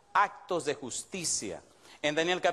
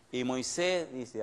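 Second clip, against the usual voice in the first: ordinary talk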